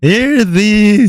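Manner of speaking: royal proclamation. Pretend. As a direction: proclaim it like a king. Proclaims as king.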